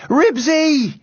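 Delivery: high yell of a dog's name. A narrator's reading. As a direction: yell after your runaway dog. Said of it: "yelled Henry".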